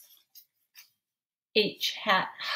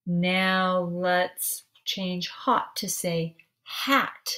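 usual speaking voice

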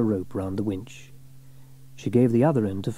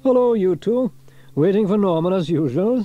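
louder cheerful greeting; friendly hello; Fireman Sam; 9 semitones higher, 7 dB louder than usual. A narrator's reading. Sam greets the twins, louder and cheerful. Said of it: "said Fireman Sam".

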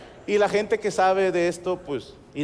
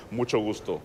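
lower, softer put-on deep greeting; faked deep voice; 7 semitones lower, 5 dB quieter than usual. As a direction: drop the voice deep on purpose. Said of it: "fingiendo la voz hacia abajo". (voz fingida grave)

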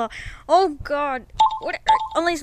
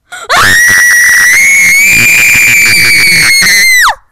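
monster scream; 16 dB louder than usual. Acted. Bunny finally does ugly scream.

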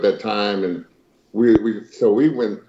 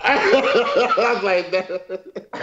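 high, laughing retelling; laughing while talking; 9 semitones higher, much slower than usual. Real, not acted. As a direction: crack up through the words. Cracking up while retelling the story.

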